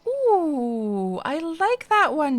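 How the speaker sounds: high, drawn-out delighted ooh; light up at the idea, slowly. Real, not acted. Streamer to chat, thrilled by the doodle reward idea, excited.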